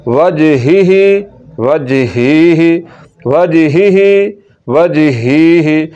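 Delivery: वजहिही slow with the हि kept short; short kasra, slow. Shown as a demonstration. Teacher recites slowly, short kasra on हि. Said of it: "इसको लंबा नहीं करना".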